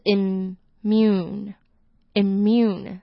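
immune said slowly, the i sounded like E. Shown as a demonstration. Teacher sounds out immune for learners, slowly. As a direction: join im and mune slowly. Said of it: "I是发E的音".

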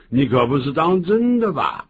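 incredulous question; disbelieving parent. Acted. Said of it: "爸妈就这样讲了".

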